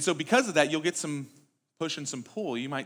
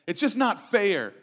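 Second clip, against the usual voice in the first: put-on whiny child; 5 semitones higher, 6 dB louder, slower than usual. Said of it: "heard your children say that".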